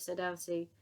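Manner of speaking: plain reading voice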